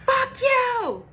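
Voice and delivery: high, loud screamed insult; retold scream. Bria screaming at Janelle as she walks off, furious. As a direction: scream it at her back. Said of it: "And she screams".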